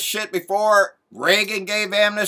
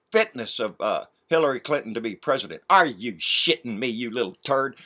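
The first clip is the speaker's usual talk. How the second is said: usual speaking voice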